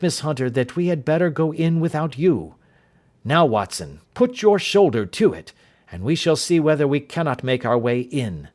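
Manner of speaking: a clipped, commanding Holmes taking charge; urgent detective giving orders; Holmes, brisk and urgent. Acted. Holmes speaks in the story.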